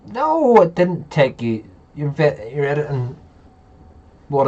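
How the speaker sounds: loud, high, protesting No; emphatic insistence. Real, not acted. Insisting on the friend's progress.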